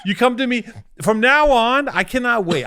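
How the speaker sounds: high, loud indignant outburst; worked-up angry tirade; mad. Real, not acted. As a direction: protest in real fury, voice pushed up. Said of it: "Look at how mad you are".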